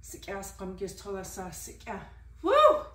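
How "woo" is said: a loud, high whoop of joy; loud cheer. Real, not acted. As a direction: let out a big excited whoop. Cheering during workout.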